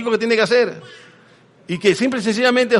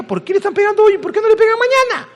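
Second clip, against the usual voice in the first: put-on very high child's voice, faster at the end; confused child; 14 semitones higher, faster than usual. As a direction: ask like a baffled little kid. El niño confundido pregunta. (el niño confundido pregunta por qué le pegan: voz muy aguda de niño)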